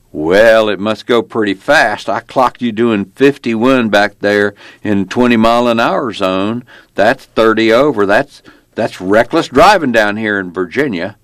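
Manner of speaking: Virginia traffic cop laying down the law; belligerent small-town cop. Acted. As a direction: play a folksy, self-important lawman, pitched a little up. Narrator plays the small-town cop.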